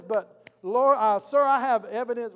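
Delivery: loud, insistent accuser; acted courtroom objection. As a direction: burst in loudly to challenge the judge. Accuser objects before the judge.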